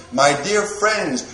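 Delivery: usual speaking voice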